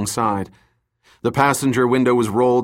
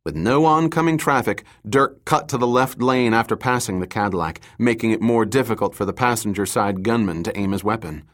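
usual speaking voice

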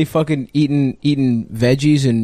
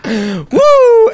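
excited whoop; 5 dB louder than usual. Real, not acted. Cheering after joke.

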